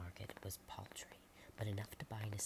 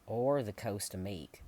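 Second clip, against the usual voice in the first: much louder, slow dry farmer; blunt farmer; 12 dB louder than usual. Acted. Farmer Pullman telling the visitors they're far from Meek. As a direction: add it flatly, heavy and slow.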